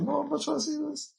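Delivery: high, fast squeaky mock of the sister refusing to look; high, hurried put-on sister voice; imitating sister. Sister covering eyes during movie.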